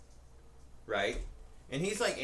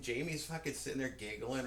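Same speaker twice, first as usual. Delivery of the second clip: normal talking voice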